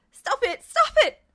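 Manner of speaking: high, loud terrified screams; panicked screaming. Acted. Ed screams as Justin grabs him. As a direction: scream it at the top of the lungs. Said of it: "he shouted".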